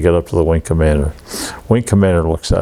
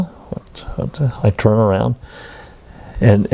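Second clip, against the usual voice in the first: usual speaking voice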